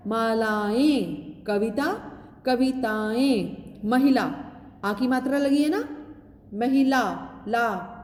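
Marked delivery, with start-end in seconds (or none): 0.1-4.2 मालाएं drawn out very slowly; slow plural model. Shown as a demonstration. Teacher slowly models plurals to students. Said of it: "माला का क्या बन गया". 6.5-7.9 महिला then ला alone to show its आ ending; sounding out the ending. Shown as a demonstration. Teacher isolates ला for the children. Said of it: "ल को आ की मात्रा".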